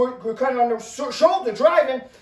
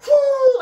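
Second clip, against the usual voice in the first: mock whoosh; 11 semitones higher, 6 dB louder than usual. Sliding crash sound effect.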